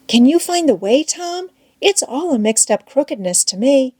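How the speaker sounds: Becky lifts her voice, a touch anxious. A narrator's reading. Becky asks Tom.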